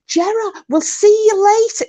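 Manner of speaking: high, loud parting call. Retold or imitated. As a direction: shout a cheery goodbye like his best mate. And I'm just like.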